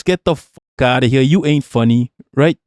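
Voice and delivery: ordinary delivery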